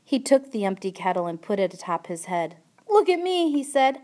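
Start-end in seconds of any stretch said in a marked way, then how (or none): 2.9-3.5 mocking mirth. A narrator's reading. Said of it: "He laughed until he cried".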